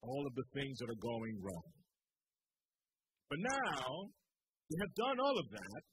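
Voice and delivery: ordinary talk, nothing marked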